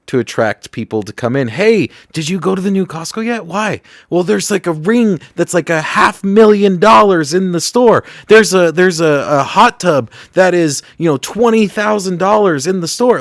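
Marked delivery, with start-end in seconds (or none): 1.5-13.2 higher, chatty gossiping shopper; excited shopper voice. Retold or imitated. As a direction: play the eager shopper spreading word.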